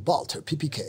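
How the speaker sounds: "P P K" in English, pee-pee-kay; James Bond. Retold or imitated. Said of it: "que siempre decía".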